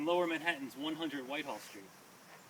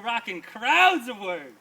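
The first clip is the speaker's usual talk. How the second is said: loud, slow, stretched-out shout; rocking crowds shout; 6 semitones higher, 14 dB louder, much slower than usual. Acted. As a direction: belt it out to the crowd. Poem building to climax.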